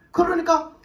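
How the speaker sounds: normal talking voice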